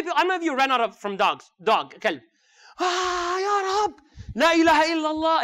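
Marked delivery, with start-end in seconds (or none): 2.8-5.4 high-pitched panicked cry to God; mock panic. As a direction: yelp prayers as if a dog were chasing you. Mimics fleeing from a dog.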